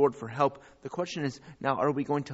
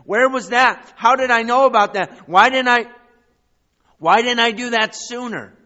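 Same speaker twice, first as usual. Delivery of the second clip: much higher and louder, fervent preacher; impassioned preaching; 11 semitones higher, 14 dB louder than usual. Acted. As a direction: cry it out to the crowd. Preacher mock self-questioning.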